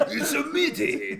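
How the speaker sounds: high, slow, loud mock-French accent; terrible French accent. Shown as a demonstration. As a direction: botch a French accent while laughing. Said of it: "Sounds like a terrible Japanese accent".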